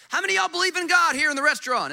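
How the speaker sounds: high, loud and fast, calling across the room; hammed-up question to a whole restaurant; playful shout to the diners; mock loud call-out. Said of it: "you could go to lunch today and go".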